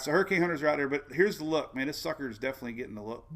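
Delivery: usual speaking voice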